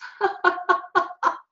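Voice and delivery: high-pitched forced laugh; deliberately put-on string of ha-has; false laugh. Shown as a demonstration. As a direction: demonstrate a laughter yoga fake laugh. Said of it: "we do false laughs".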